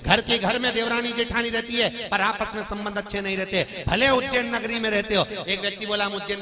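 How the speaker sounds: loud, high sermon voice; full-force preaching. Acted. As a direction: proclaim it to the whole gathering. Preaches on family relations at full force.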